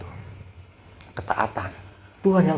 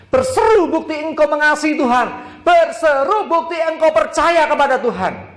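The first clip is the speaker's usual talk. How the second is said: preaching crescendo; 10 semitones higher than usual. Acted. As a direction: lift the voice to cry out. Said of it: "ayo belajar berseru".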